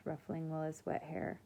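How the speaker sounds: usual speaking voice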